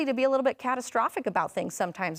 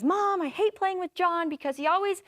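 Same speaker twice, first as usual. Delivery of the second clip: put-on higher-pitched upset kid voice; upset nine-year-old son; 6 semitones higher than usual. Mom voicing son bursting in upset, higher childlike whine. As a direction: whine it like a hurt little boy. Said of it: "he was just so upset".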